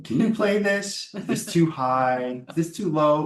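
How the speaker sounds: a hint of Megan asking the cellist; quoting Megan. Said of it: "She'll come to me for a consultation".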